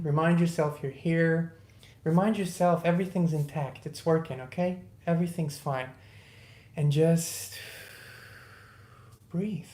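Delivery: guided meditation. Acted. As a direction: ease the listener into a few breaths. Leads a short breathing meditation.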